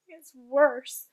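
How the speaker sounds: loud emphatic giant; loud cry. Acted. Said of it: "cried the B F G".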